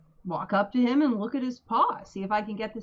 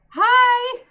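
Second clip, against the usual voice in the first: cheery shout; 12 dB louder than usual. Acted. Character greets the wolf loudly.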